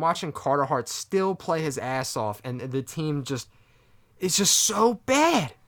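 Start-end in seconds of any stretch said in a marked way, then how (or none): 4.2-5.5 tag exasperated. Real, not acted. Disgusted fan venting about his team.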